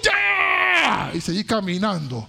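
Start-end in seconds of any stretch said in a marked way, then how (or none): 0.0-1.1 high, long-held hallelujah cry; elated holler of praise; praise shout. Retold or imitated. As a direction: shout it out, stretching the vowels. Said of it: "volví y grité".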